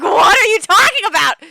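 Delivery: high, loud disbelieving cry; exasperated mom shout. Retold or imitated. Mom yelling at child who says he is going to hell. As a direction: blurt it out, losing patience. Mom asks a third time.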